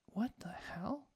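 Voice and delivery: slow, breathy whisper to herself; whispered what the hell. Retold or imitated. Whispering her own disbelief about the echo chamber. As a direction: hush it like a private aside.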